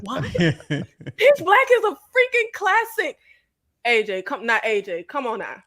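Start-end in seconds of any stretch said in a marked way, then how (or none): 0.2-3.2 indignant. Real, not acted. Indignant defense of favorite.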